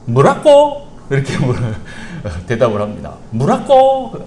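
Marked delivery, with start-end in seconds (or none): 0.1-0.9 dad's punchline. Acted. Dad potato answers. 3.3-4.1 dad's punchline. Acted. Repeats dad's answer.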